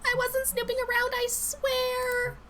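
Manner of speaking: very high, small pleading voice; anxious Lindsay. Acted. Frightened coworker swearing she wasn't snooping, high and pleading.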